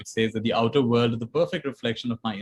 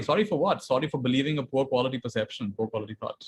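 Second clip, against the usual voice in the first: ordinary talk, nothing marked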